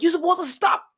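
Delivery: high mock voice of the angry driver; the other driver. Rasta man scolding the young driver, high mock voice. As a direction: mimic the man scolding back. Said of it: "And he's like".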